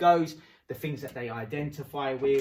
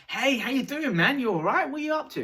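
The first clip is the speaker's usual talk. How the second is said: loud, much higher, over-the-top hello; overeager greeting; 12 semitones higher, 6 dB louder than usual. Shown as a demonstration. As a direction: bound up to a stranger all hyped. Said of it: "run up to them and say".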